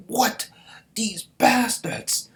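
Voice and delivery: very high, loud, slow outrage; shocked then cursing. Acted. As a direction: spit out the insult in disbelief. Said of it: "The Zhao are like".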